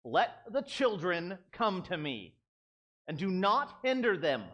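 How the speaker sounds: slow, firm indignant command; stern, measured indignation. Acted. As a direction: lay it down word by word, indignant, falling at the end. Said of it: "When I'm indignant, I'm like".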